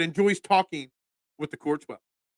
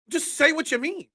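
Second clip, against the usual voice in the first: high-pitched exasperated plea; 11 semitones higher than usual. Real, not acted. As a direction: plead in exasperation, pitch jumping up. Exasperated rant about slang.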